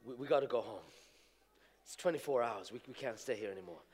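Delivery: soft, low, pleading wife. Retold or imitated. Wife begging husband to leave the airport, hushed and low. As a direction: quietly beg him to come home. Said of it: "my wife coming back to me and she says".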